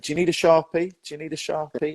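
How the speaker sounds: high-pitched imitation of a keen helper; over-eager offer. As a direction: pipe up with pestering, over-helpful questions. Acting out guard's pestering offers.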